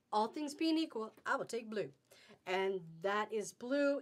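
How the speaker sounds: ordinary talk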